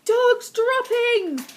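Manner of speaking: very slow, loud, high shout. Acted. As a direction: howl it out in disgust. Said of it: "they shouted".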